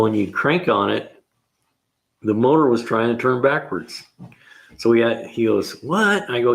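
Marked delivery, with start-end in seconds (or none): 5.8-6.3 high-pitched mock astonishment; retold incredulous exclamation; surprised. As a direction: imitate a coworker in disbelief, voice jumping up. Retells coworker's disbelief.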